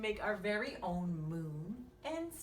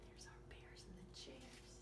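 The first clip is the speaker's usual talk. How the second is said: soft whisper; 21 dB quieter than usual. Acted. Pointing out the bears, whispered. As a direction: whisper it to the child.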